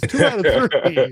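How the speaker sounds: loud, drawn-out mock insistence; insistent demand. Choked-out fighter to referee, loud comic insistence. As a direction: imitate a loser demanding a rematch, slowly. Repeats the rematch demand, laughing.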